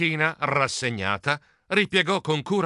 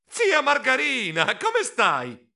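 higher squeaky greeting to aunt; high squeak; 9 semitones higher, slower than usual. Acted. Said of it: "squittì".

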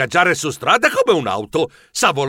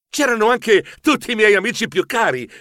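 ordinary delivery, nothing marked

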